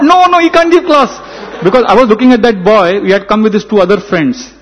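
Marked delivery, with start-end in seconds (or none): 0.0-1.1 much higher, louder alarmed no-no; shocked protest. Retold or imitated. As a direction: blurt it out in retold panic. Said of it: "I was shocked".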